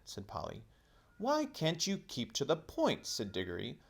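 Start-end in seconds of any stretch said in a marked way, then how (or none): 1.2-3.0 exasperated. Acted. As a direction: demand it, fed up. Exasperated Diggory.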